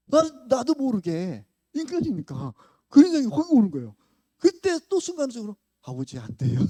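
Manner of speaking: loud, high, impassioned preaching; full-force preaching. Real, not acted.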